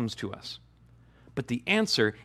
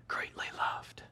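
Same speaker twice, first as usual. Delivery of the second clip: voiceless whisper; whispered divine tenderness; whispered Savior voice; 15 dB quieter than usual. Acted. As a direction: breathe it like a secret. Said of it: "hearing that from the Savior himself".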